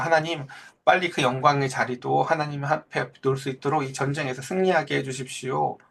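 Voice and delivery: ordinary delivery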